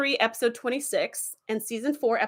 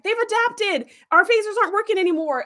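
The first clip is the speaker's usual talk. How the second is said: mock alarm; 9 semitones higher than usual. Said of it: "they're like".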